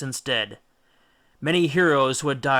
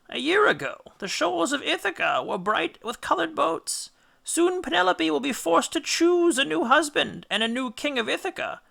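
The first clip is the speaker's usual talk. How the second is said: high, wailing mother's voice; ghost mother; 14 semitones higher than usual. A narrator's reading. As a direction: fret for her son's home.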